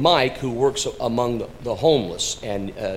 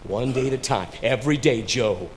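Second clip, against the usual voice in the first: usual speaking voice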